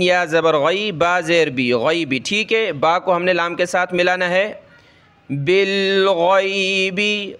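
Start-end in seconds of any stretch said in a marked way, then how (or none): 5.3-7.3 بالغیبی with a heavy غ from the throat; teacher shows the heavy ghain; throaty ghain. Shown as a demonstration. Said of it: "غین کو گلے سے نکالیں گے موٹا کر کے".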